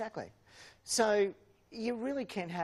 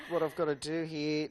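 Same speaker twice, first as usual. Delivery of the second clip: ordinary talk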